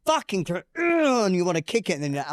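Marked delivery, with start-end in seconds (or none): 0.8-1.3 high-pitched frustrated "ugh"; acted-out growl of exasperation; angry groan. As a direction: groan in mock fury at yourself. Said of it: "I get angry at myself".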